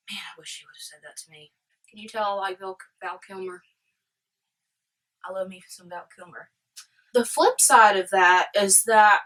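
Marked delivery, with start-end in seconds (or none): 0.1-6.5 hushed, near-whispered confession; smitten aside. Real, not acted. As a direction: drop to a very soft private murmur. Smitten aside about the actor.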